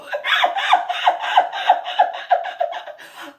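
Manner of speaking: high, loud breathless laugh; high wheezing laugh. Real, not acted. Loud high laugh at grandpa praising the biting dog.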